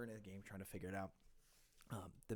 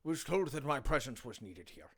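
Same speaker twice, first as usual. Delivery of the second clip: a nervous, stuttering officer voice; stammering captain; 5 semitones higher, 9 dB louder than usual. Acted. Captain arriving to greet the guards, nervously. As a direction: stumble over the greeting, then steady. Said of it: "And you hear".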